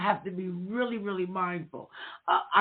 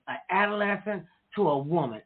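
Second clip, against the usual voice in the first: ordinary delivery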